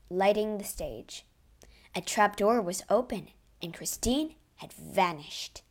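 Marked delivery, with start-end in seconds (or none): none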